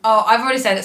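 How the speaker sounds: ordinary talk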